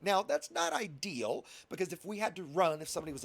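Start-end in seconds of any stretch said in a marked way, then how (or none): none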